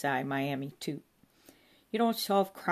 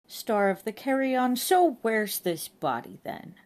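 brusque loud police voice; loud officer; 6 semitones higher, 7 dB louder than usual. A narrator's reading. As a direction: bark it like a blunt copper.

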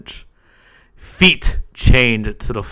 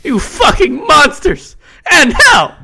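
shrill high scream, much louder; screaming rage; 8 dB louder than usual. A narrator's reading. Stefan screams at the hooded monsters, shrill and high. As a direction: shriek curses at them.

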